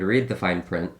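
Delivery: ordinary talk, nothing marked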